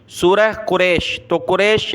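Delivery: usual speaking voice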